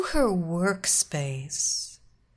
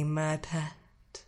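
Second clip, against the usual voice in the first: ordinary delivery, nothing marked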